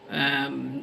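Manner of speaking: ordinary talk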